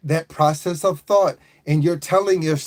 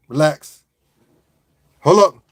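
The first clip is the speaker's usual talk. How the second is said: ordinary talk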